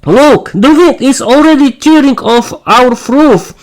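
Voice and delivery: high, frantic cry for help; frightened high shout; panicked shout. Acted. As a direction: yell in panic as the roof tears off. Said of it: "the family shouted in panic".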